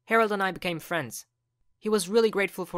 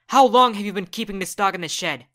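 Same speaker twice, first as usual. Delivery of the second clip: angry mom; 3 semitones higher, 9 dB louder than usual. Acted. Parents freak out, mom yells.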